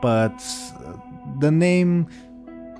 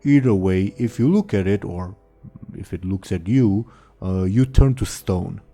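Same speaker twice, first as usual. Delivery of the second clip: dopey ogre voice. Acted. GM voicing the ogre.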